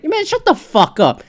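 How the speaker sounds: sharp, high retort at a troll; retorting comeback. Retold or imitated. Retorting to an internet troll, sharp and high. Snapping back at internet commenter.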